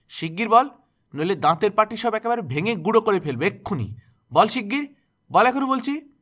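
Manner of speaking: high, loud, building to a shrieking order; furious demand. A narrator's reading. Aunt dragging Durga to the middle, shouting demands. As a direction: hammer the command until it cracks.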